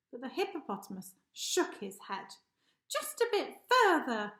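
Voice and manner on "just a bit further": higher, louder proud hippo. Acted. Hippo proudly answering the lion, louder and higher. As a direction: say it with proud determination. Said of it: "he proudly said".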